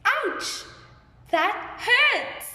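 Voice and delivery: high-pitched acted pain; yelp, then complain of the hurt; demonstrated cry of pain. Shown as a demonstration. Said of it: "I am very sad".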